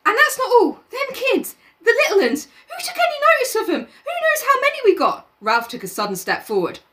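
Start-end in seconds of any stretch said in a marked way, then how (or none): 0.1-5.3 put-on much higher boy, fast and half laughing; high anxious schoolboy; Piggy voice, worried rant. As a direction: fret about the little uns. Piggy voice held.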